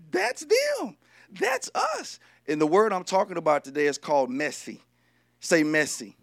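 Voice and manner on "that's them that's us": exaggerated falsetto cry of recognition; sing-out high and drawn, like a punchline; high-pitched exclamation. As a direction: squeal it high in mock realization. Punchline of looking the word up.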